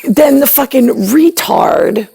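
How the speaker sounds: ordinary talk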